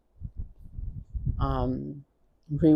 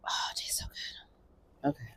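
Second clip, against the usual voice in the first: whisper; 8 dB quieter, much faster than usual. Real, not acted. Savoring coconut water.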